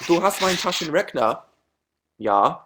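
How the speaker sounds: put-on German accent. Shown as a demonstration. Vlogger redoing his mocked German line for viewers, German accent. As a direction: have a go at a mock German accent. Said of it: "that was the worst German accent ever".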